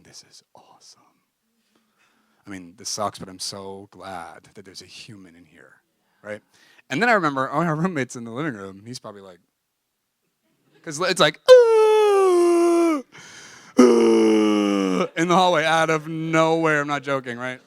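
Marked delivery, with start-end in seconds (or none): 0.0-1.0 breathless whisper to himself; whispered thought. Retold or imitated. Thinking it while hugging his crying friend, whispered. 11.5-15.1 high, loud hammed-up wail; mock wailing. Imitating the roommate's wailing heard in the hallway, loud mock wail.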